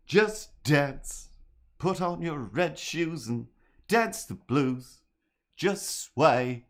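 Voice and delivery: sing-speak like Bowie; lazy Bowie croon; [name] voice. Acted. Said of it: "the [name] voice".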